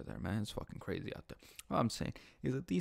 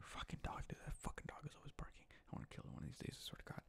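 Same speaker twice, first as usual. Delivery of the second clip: muttered, hushed complaint; a very soft irritated whisper; annoyed whisper; 4 semitones higher, 13 dB quieter, faster than usual. Real, not acted. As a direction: grumble it under your breath. Annoyed at the barking dog.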